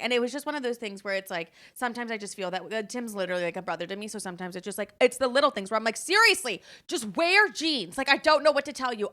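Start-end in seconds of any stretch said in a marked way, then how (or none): 6.0-9.1 high, loud exasperated outburst. Retold or imitated. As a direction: drag out the words in pleading exasperation. Acted exasperation with her friend.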